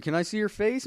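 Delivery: high, sweet mock girlfriend voice; high falsetto girly greeting. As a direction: pitch way up to play the lady friend. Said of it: "and, like".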